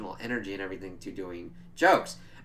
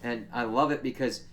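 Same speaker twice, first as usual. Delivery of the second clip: ordinary talk, nothing marked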